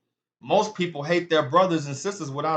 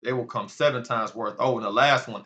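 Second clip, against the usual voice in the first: normal talking voice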